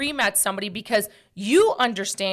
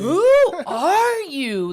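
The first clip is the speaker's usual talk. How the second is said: slow, higher, louder, laughing through it; indignant disbelief; 6 semitones higher, 7 dB louder, much slower than usual. Retold or imitated. Reacting to a rude stranger, slow appalled disbelief. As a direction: stretch it out, appalled. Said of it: "And I'm like".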